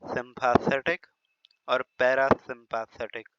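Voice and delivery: slow, careful सिंपैथेटिक with the tongue rolled. Shown as a demonstration. Teacher demonstrating the rolled-tongue way to say it. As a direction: roll the tongue through पैरासिम्पैथेटिक, slowly. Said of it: "टंग को थोड़ा सा ऐसा रोल करें".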